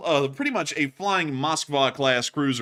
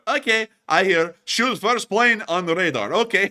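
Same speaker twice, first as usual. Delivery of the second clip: higher, louder, eager mock soldier voice; dim soldier; 6 semitones higher, 5 dB louder than usual. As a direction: blurt it like a clueless grunt. Dim subordinate repeats his orders.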